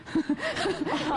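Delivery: high burst of laughter; high, amused giggling. Real, not acted. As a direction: laugh at your own verdict. Laughs after admitting song won't hit.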